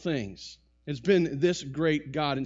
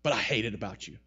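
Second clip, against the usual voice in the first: backhanded compliment; 3 semitones higher, 10 dB louder than usual. Retold or imitated. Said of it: "He's like".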